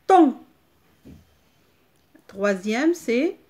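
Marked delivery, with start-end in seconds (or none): 0.1-0.4 loud, high syllable; emphatic dictation. Shown as a demonstration. Teacher reads the spelled syllable to children, emphatically. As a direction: call out the finished syllable.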